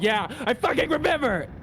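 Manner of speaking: high, yelled mock protest; exasperated retort. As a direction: snap back high, at the end of your patience. Acted player nagged about killed dog.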